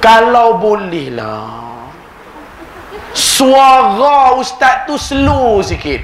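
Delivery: a slow Kedah drawl, delicately asking; Kedah accent. Retold or imitated. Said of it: "Saya ajuk orang Kedah".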